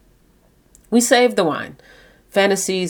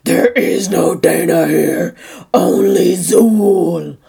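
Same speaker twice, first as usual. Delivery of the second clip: loud, very slow possessed demon; Zool demon voice; 5 dB louder, slower than usual. Retold or imitated. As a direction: intone it slowly like a possessing demon. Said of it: "Her voice dropped all demon-like".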